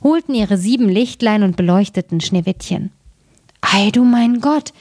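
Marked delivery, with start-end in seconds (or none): 3.6-4.7 exclaimed wonder. A narrator's reading. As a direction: marvel aloud in a raised pitch, without shouting. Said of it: "riefen sie".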